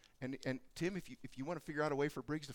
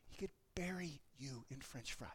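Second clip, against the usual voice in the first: soft whispered aside; a preacher whispering a big truth; 4 semitones lower, 10 dB quieter than usual. Real, not acted. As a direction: hush it to a secret. Whispered point.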